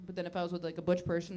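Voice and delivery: usual speaking voice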